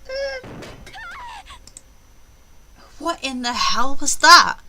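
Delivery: high, loud, slow frightened cry; frightened outburst. Acted. Agent startled by a ghostly sound, frightened. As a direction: jolt in fear at the noise.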